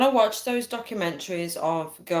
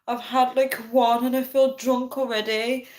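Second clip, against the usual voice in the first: high-pitched pleading, imitating herself; retold desperate phone confession; 7 semitones higher, 3 dB louder than usual. Tipsy woman confiding in her friend on the phone, desperate. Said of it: "my desperate voice on the phone".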